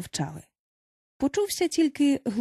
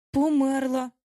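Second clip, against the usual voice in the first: higher, lost-sounding statement; bewildered; 4 semitones higher, slower than usual. A narrator's reading. Peter looks at dead Wendy, bewildered. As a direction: say it at a loss, pitch lifted. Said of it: "розгублено мовив він".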